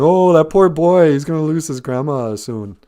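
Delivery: mildly hammed-up sympathy, starting high and loud; mock pity. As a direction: pretend to pity the poor boy. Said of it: "It's not like we're all going".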